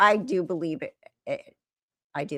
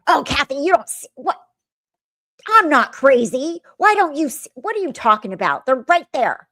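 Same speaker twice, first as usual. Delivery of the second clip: higher, louder, protesting that she is not crazy; 6 semitones higher, 6 dB louder than usual. Retold or imitated. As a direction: argue hard that the children are right there.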